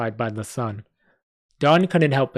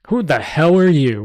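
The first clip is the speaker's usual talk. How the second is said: loud, sharp challenge from a stranger; a hostile patron confronts the knights, loudly; rough patron; 4 semitones higher, 7 dB louder than usual. Acted. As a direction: bark it at the newcomers, hammed-up. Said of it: "one of the patrons asked".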